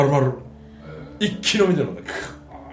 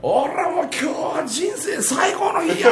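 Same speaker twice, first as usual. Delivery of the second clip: put-on 1 drunken joyful shout; 11 semitones higher than usual. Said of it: "とか言ってて".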